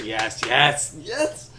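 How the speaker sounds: excited cheer. Real, not acted. Celebrates beating his wife.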